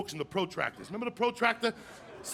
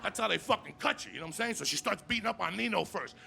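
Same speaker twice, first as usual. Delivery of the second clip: normal talking voice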